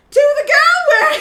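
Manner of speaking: high, loud English accent, cracking up; hammed-up English accent, breaking into laughter. Shown as a demonstration. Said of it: "read it with an English accent".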